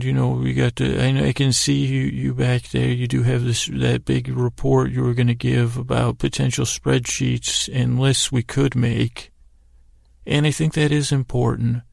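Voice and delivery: ordinary talk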